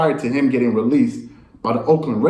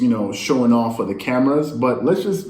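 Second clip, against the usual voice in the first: ordinary talk, nothing marked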